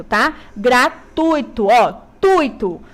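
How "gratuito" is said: "Gratuito" drawn out slowly, tui kept in one syllable; careful, slow model "Gratuito"; slow correct gratuito. Shown as a demonstration. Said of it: "tuito, é junto".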